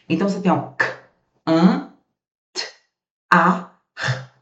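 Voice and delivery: sounding out each phoneme separately; phonemes split apart. Shown as a demonstration. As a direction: break the word into its sounds for the class. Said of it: "Cinco fonemas".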